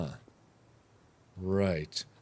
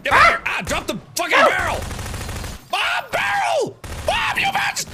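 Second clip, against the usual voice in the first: high frantic shouting; panicked yelling. Real, not acted. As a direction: yell it in a panic. Panicked yelling during attack.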